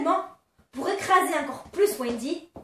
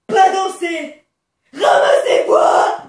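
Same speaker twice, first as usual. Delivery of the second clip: loud caricature of broken French; mock chief voice; 11 dB louder, slower than usual. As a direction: bark it like the chief. Imitating chief's clipped order. (chef caricatural crié)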